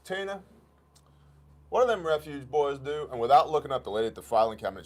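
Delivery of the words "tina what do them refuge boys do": rural clerk calling out. Retold or imitated. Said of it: "she goes".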